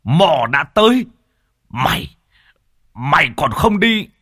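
high, loud commanding shout at the demon; shaman roaring at the snake demon to come down. Acted. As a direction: bellow it like an exorcist. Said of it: "thì lập tức quát lên".

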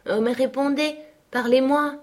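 high, urgent entreaty; pleading. A narrator's reading. Catherine begging Linton to speak, pleading.

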